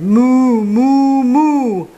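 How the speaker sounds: loud, high, long moos; mooing. A narrator's reading. Duck pretends to be a cow, mooing loudly. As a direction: moo repeatedly at full voice. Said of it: "Then the duck on the pond said".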